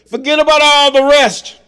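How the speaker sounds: high, loud preaching cry; preaching shout. Real, not acted. Preacher urging his flock, loud and high.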